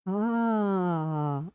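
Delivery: very slow, soft, drawn-out "हाँ"; remembering "हाँ". Shown as a demonstration. Teacher showing the aa of recalling something, long and soft. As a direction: stretch "हाँ" softly as a memory surfaces. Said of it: "ऐसे बोलते".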